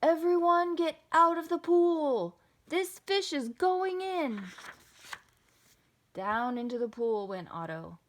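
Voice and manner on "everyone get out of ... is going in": high, loud warning shout; yell. A narrator's reading. Firemen clearing everyone out of the pool, yelling. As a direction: holler an urgent order to a crowd. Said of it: "The firemen yelled".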